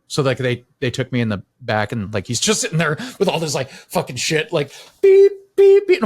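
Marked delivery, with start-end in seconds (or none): 5.0-6.0 high, loud imitated machine beeps; piercing spoken beeps, evenly repeated; monitor beep imitation. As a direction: mimic a hospital monitor, pitch way up.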